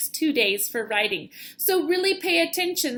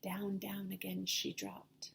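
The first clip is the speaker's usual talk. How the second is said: hushed, breathy whisper; whispered narration; 5 semitones lower, 14 dB quieter than usual. A narrator's reading. Teacher reading aloud to children, hushed whisper. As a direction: whisper it to build suspense.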